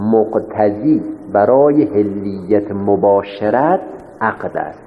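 very slow, measured phrasing, dropping at the end; slow dictation. Real, not acted. Teacher restates premise as dictation. (خیلی شمرده و کشیده، به حالت دیکته)

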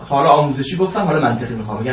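usual speaking voice

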